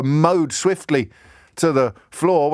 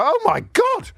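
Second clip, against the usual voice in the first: "Oh my God!" shot up high in mock horror; imitating a shocked public, pitch soaring; shocked gasp. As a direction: act like it's the first scandal you've ever heard of. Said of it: "we all go".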